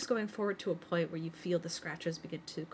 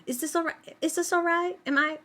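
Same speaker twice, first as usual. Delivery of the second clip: put-on much higher, a timid little kid's question; a small child asking shyly, pitched way up; 11 semitones higher, 10 dB louder than usual. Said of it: "with just a quiet little".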